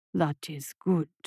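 deep, slow, soft beast; monster voice. A narrator's reading. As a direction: purr approval like a monster. Monster approves.

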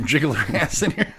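repeating the words through a laugh. Real, not acted.

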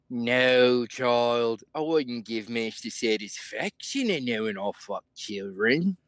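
put-on adult answering a child; mannered impression, back down from the child's squeak; guessing-game impression. Answering the child in the guessing game, as a mannered impression. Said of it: "I got a new impression I've been working on".

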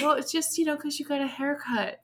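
high, sweet mock of a kind clerk; a sugary consoling clerk, lightly played. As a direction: gently excuse it like a polite clerk. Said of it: "she was so kind".

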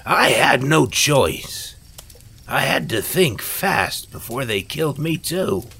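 high-pitched, emphatic protest, loud at first; heated self-defence. A narrator's reading. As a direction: protest hard against the accusation. Accused villain defends himself heatedly.

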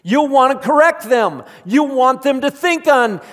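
loud, high, fervent preaching to the congregation; full-force preaching. Acted. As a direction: preach it out, rising and pressing.

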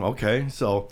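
normal talking voice

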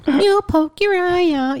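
high, slow quoting voice; squeaky mock warning, slowly. Quoting the movie line.

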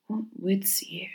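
slow breathy whisper. Acted. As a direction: whisper it slowly, far away. Stranger repeats to himself.

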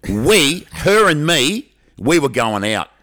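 loud, stretched-out stressed words, high on the first; mock outrage, each phrase dragged out and set apart; aggrieved accusation. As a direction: act out a jilted kid's melodrama. Kid acted as jilted, blaming the girl.

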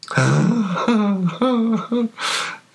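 put-on very high childlike laughter, long and excited; excited laugh with a sob underneath; Leaf. Game master as Leaf showing off hands, high sobbing laugh. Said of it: "is excited and laughing".

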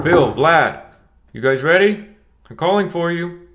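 Liv's father calling at the locked door, loud; calling through door. Acted. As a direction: call out to the boys behind the door. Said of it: "shouted Liv's father".

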